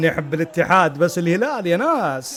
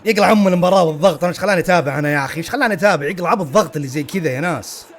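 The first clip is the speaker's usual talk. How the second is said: loud, very fast grumbling; exasperated rant; 3 dB louder, much faster than usual. Real, not acted. Fan cursing the pressure of the match, exasperated rant. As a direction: burst out in stressed frustration. Rants about stressful match.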